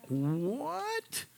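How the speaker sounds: high, startled, disbelieving what; stunned what. Retold or imitated. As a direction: jump way up in disbelief on the word. Stunned reply to 4am liver call.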